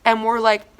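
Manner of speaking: ordinary delivery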